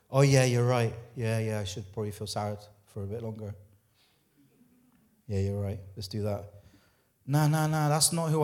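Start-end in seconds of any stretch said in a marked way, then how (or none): 0.1-6.5 much lower and softer, limp giving-in voice; mock the self-pitying yes-man, low and deflated; mock defeated agreement. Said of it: "Is that how we talk?".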